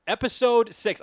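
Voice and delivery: emphatic announcement. Real, not acted. Host kicking off episode six to co-host, emphatic announcement. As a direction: belt out the episode title.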